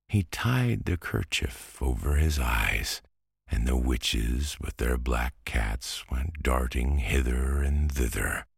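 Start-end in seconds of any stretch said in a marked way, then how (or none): none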